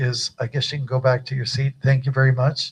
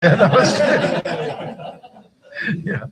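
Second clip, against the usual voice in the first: namaste said through laughter, much higher and slow; laughing reply; 9 semitones higher, 4 dB louder, much slower than usual. Real, not acted. Guru answering a joking namaste, laughing. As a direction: laugh along while repeating it. Laughing after back alley joke.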